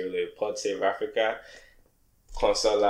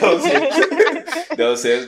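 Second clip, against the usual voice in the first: laughing; 10 dB louder, much slower than usual. Real, not acted. Repeating joke while laughing.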